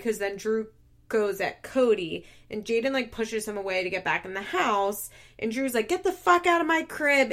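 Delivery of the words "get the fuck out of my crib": higher, louder mock yell; retold angry yell. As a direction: imitate an angry man ordering someone out. Retold shouting match, Drew's line.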